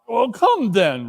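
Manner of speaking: high, breezy retort. Acted. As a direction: toss it back cheerfully. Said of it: "replied the nephew gaily".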